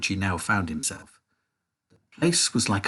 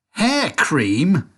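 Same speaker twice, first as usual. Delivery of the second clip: cried; 5 semitones higher, 4 dB louder than usual. A narrator's reading. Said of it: "cried Mike T V".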